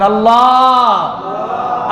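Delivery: long-drawn, loving call of Allah; tender devotional call. Shown as a demonstration. Preacher shows congregation how to call Allah lovingly, tender devotional call. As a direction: draw the name out lovingly. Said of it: "সুন্দর করে মায়াবী কণ্ঠে ডাকবেন".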